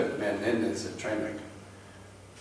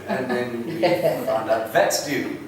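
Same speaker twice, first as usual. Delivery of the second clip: normal talking voice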